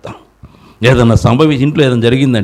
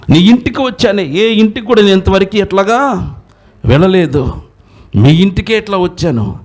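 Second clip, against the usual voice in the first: fiery preaching; 11 semitones higher than usual. Acted. Preacher rebuking the congregation for weak faith, fiery preaching. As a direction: preach it hard and rising to the crowd.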